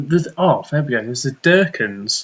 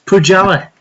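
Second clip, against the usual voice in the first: put-on Yorkshire, a little raised; Yorkshire accent demo; 5 semitones higher, 6 dB louder than usual. Shown as a demonstration. Showing how Yorkshire says Pujala, mock Yorkshire accent. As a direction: demonstrate a mock Yorkshire pronunciation. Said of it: "The Yorkshire pronunciation of Pujala".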